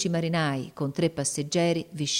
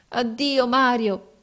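high, tearful farewell call; acted sobbing cry of goodbye; grido fra i singhiozzi; 7 semitones higher, 4 dB louder, slower than usual. A narrator's reading. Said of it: "gli gridò fra i singhiozzi". (addio gridato, tono di pianto)

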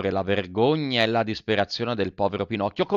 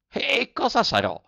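much higher, frightened puppet question; Pinocchio, alarmed; 14 semitones higher than usual. A narrator's reading. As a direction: gasp it out in dread. Pinocchio voiced high. (domanda acuta e spaventata del burattino)

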